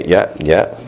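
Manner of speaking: ordinary talk, nothing marked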